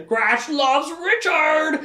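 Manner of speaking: high, drawn-out creature cry; creature Gratch. Acted. Creature running to declare love for Richard, high creature cry. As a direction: stretch the words in a high beast voice.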